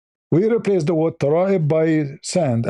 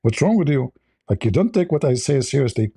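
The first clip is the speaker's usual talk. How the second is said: ordinary talk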